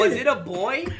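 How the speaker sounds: high, leading psychic question; psychic reader voice. Retold or imitated. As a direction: play a cheesy medium fishing for clues.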